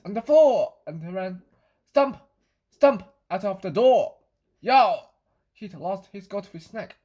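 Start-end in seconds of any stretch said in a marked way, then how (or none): 1.9-4.2 stomping words. A narrator's reading. Cat stomps out. 4.6-5.1 a short loud yowl; loud cat yowl. Acted. The cat, robbed of its snack, yowls loudly. Cat's cry leaving.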